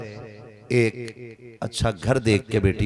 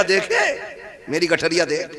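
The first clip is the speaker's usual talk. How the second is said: lightly imitated show-off, pitched higher; higher-pitched playful boast; mock boasting; 5 semitones higher than usual. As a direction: mimic someone proudly showing off a bundle. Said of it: "और निकल के कह रिया".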